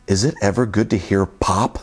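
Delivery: usual speaking voice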